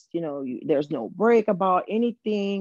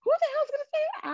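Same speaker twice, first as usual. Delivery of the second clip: high, fast disbelieving squeal; shrill mock disbelief; incredulous; faster than usual. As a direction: act stunned he'd admit it. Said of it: "And I was like".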